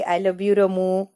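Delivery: slow, drawn-out "I love you रमू"; imitating Akshay. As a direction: stretch every word of "I love you रमू" out slowly. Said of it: "अक्षय राव कसं आपल्या रमूला म्हटले".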